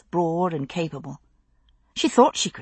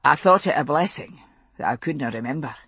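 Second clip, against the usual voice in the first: lower, steady Highland father; Scottish father voice; 4 semitones lower, faster than usual. Acted. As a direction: speak as a grave Scottish father.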